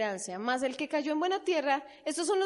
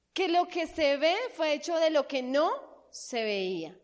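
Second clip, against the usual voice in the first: ordinary talk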